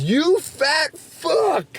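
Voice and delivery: high, drawn-out taunting yell; gloating shout. Retold or imitated. Friend crowing over his weigh-off win, gloating shout. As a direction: stretch each word into a long, high-pitched jeer.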